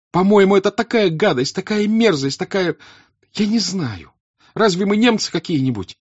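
very high, loud, indignant tirade; Natasha's angry outburst. Acted. Natasha raging at Petya, indignant and shouting. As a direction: spit out the disgust, near tears. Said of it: "озлобленное лицо".

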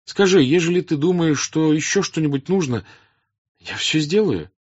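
high, loud earnest plea; urging princess. Acted. Princess presses an old man to answer, urging.

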